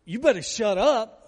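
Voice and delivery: loud, menacing shut up; threatening warning. Shown as a demonstration. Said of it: "isn't it more like".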